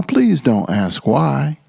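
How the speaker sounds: ordinary delivery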